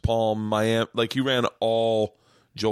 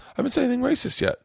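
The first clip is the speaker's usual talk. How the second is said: mock high comeback; much faster than usual. Retold comeback to heckler.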